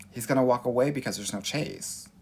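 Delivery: ordinary talk, nothing marked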